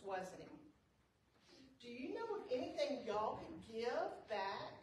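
ordinary delivery, nothing marked

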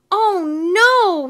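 high, loud wail of dismay; drawn-out alarmed exclamation; crying out. A narrator's reading. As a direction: cry out as if falling. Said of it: "he cried".

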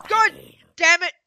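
loud, high angry curse; frustrated outburst. Real, not acted. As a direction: blurt it out in annoyance.

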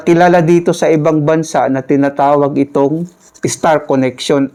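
ordinary talk, nothing marked

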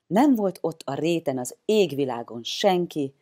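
ordinary delivery, nothing marked

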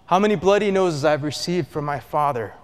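ordinary talk

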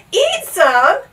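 loud, high shocked question; gasped horror. A narrator's reading. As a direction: gasp it in disbelief. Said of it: "gasped Bartholomew".

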